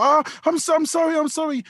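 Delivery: high-pitched repeated apology; apologetic woman. Retold or imitated. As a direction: imitate a woman anxiously saying sorry. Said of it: "the woman I'm talking to was quite apologetic".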